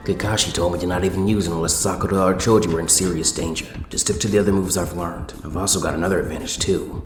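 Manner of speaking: low, soft, thinking aloud inside; inner thought voice. A narrator's reading. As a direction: keep it low like a private thought. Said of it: "Sasuke thought".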